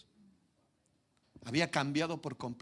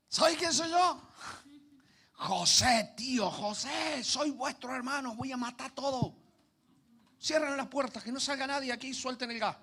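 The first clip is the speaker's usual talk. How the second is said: mock furious Joseph; 9 semitones higher than usual. Mock vengeful Joseph, absurd.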